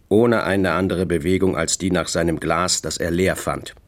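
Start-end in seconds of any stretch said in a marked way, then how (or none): none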